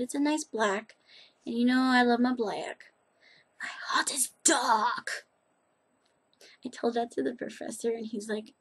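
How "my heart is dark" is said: slow, breathy mock-spooky whisper; dramatic whisper. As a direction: whisper it like a dark secret.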